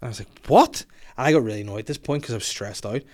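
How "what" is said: sharp high outraged what; shocked what. Retold or imitated. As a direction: react in disbelief. Shocked at insult.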